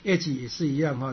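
ordinary delivery

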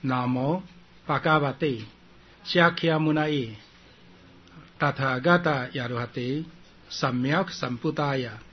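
measured, phrase-by-phrase Sanskrit reading; Sanskrit recitation demo. Shown as a demonstration. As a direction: model the Sanskrit title for students, pausing between phrases. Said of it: "我再先念一遍给你们听".

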